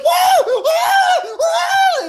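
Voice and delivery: high, loud panicked whoa; shrill retold panic yells; screaming whoa. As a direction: scream it in mock terror. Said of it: "Jesse's like".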